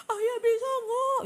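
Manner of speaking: high, loud sobbing cry, dragged slow; crying yell. Retold or imitated. Wife crying and yelling at her husband, loud sob. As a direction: wail it through tears. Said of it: "Ini sambil nangis, sambil teriak".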